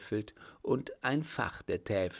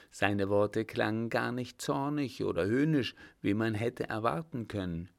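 plain reading voice

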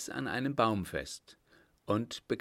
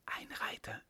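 hushed, breathless whisper; 9 dB quieter than usual. A narrator's reading. Vagabond warning comrades, whispered. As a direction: whisper it so the rider can't hear. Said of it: "flüsterte einer".

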